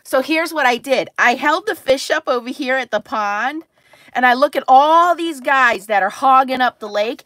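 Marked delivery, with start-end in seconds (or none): none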